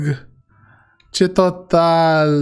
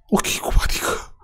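voiceless, softer gasp of disbelief; stunned whisper; 5 dB quieter than usual. Acted. Shopper hearing the fridge's price, whispering in shock. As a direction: whisper it as if the breath has gone.

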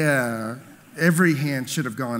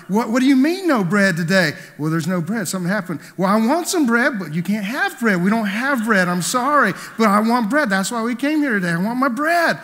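higher, louder whiny demand for bread; mock angry demand; 7 semitones higher, 6 dB louder than usual. Imitating the ladies demanding bread from the waitress, mock indignant. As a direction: play the offended customer with big indignation. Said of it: "They were getting angry".

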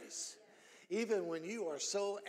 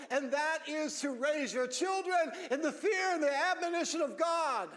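preaching at full force; 7 semitones higher than usual. Acted. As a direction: drive it home from the pulpit.

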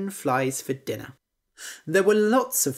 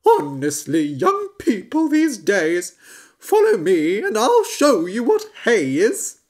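loud, higher, exasperated; grumpy old horse; 6 semitones higher, 7 dB louder than usual. Acted. Horse scolding the young frog, loud. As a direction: sigh at young people then lead on.

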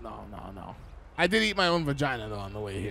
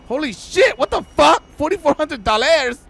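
loud, high, stunned yell at the money; jaw-dropping loud surprise; shocked shout; 7 semitones higher, 8 dB louder than usual. Acted. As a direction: shout it out in disbelief. Shocked at the huge tip.

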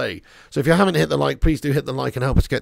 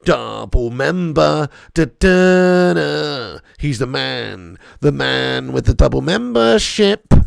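slow, drawn-out fanfare announcement; mock announcer; 6 dB louder, much slower than usual. Streamer hailing a viewer's double membership, drawn-out mock announcer. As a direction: stretch each word like a showy announcer.

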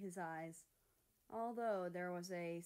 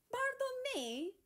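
much higher, slow, affronted; drawn-out mock outrage; offended; 7 dB louder than usual. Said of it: "Mexico narrowed his eyes".

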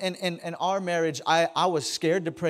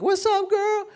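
high, bright mock greeting of a nurse; friendly nurse greeting; 3 dB louder than usual. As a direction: squeal it like an old friend on the phone. Nurses greeting the wife by phone.

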